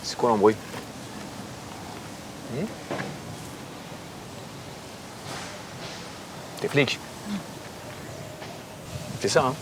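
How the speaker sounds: put-on French with a Russian accent. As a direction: speak like a Russian. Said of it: "avec un accent russe?".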